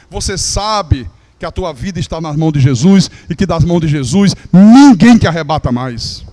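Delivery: loud, rising sermon proclamation; preaching at full force. Acted. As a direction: proclaim it to the congregation with power. Preaching climax. (pregação em alta voz, com força total)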